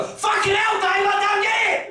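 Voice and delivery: very high mock yell of bewilderment; baffled shouting. Londoners baffled by the comic at a party, high confused shouting.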